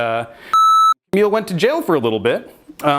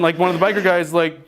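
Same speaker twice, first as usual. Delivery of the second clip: ordinary talk, nothing marked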